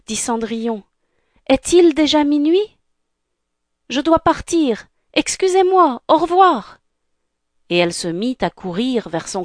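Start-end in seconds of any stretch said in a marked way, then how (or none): 1.5-6.8 high, hurried-sounding goodbye; flustered panic. Acted. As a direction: panic and excuse yourself, pitched well up. Cinderella panics and must leave. (affolement, voix aiguë)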